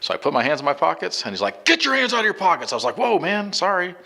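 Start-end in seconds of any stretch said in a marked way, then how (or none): 1.7-2.7 high-pitched mock cop yell; barked police order. Cop ordering a driver to take hands out of pockets, barked command. 3.0-3.9 high, taken-aback retold apology; startled apology.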